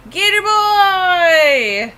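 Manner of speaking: drawn-out, high, loud delighted cry; excited cry. Real, not acted. Streamer seeing a fan drawing, delighted drawn-out cry. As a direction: stretch it out in pure delight.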